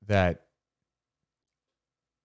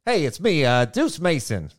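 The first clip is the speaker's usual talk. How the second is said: put-on old reporter voice; 5 semitones higher, slower than usual. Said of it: "I talk like an old reporter".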